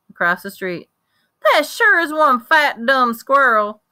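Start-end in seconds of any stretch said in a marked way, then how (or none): 1.5-3.8 put-on excited country boy. Boy admiring squirrel, loud.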